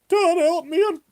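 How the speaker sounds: very high, loud mock panicked plea; imitated cry for help. Telling how tough grown men wake crying for him, mock-terrified. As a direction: mimic a helpless sleeper crying out. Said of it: "like, tough guys going".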